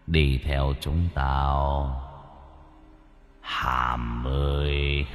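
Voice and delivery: low, slow, hollow voice from the underworld; gloomy ghostly voice. A narrator's reading. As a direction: drag each word out low and soft like a call from beyond the grave. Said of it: "cất tiếng bằng giọng âm u, như từ cõi âm vọng về".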